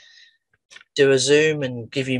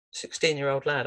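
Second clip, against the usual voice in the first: normal talking voice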